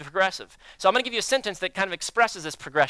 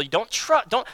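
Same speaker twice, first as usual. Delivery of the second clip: usual speaking voice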